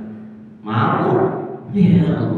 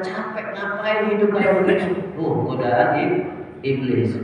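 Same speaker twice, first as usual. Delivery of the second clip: ordinary talk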